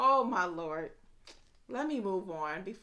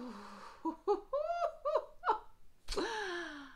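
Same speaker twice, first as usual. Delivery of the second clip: high giddy laugh; 11 semitones higher, 4 dB quieter than usual. Real, not acted. Delighted at the smell.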